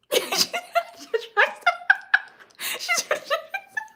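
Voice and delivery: a very high, squealing burst of laughter. Real, not acted. Laughing at her own panic over the mice, high squealing laugh.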